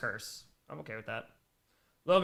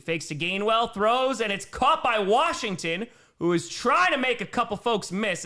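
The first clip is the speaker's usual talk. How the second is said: louder play call, rising as the pass is caught; live play-by-play; 3 semitones higher, 5 dB louder than usual. Real, not acted. Streamer calling a Gainwell catch for viewers, live play-by-play. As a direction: call the action as it unfolds.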